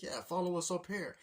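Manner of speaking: high eager host; cheery client voice. Retold or imitated. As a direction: lead them upstairs, chirpy and high.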